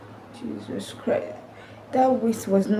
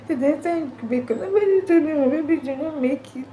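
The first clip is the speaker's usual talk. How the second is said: high, crying, voice breaking; weeping while telling; sobbing; 4 semitones higher than usual. Real, not acted. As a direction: speak through real tears. Grief over babies' death.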